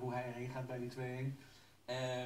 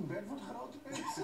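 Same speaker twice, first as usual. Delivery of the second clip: laughing while talking; 7 semitones higher than usual. Real, not acted. Teasing through laughter.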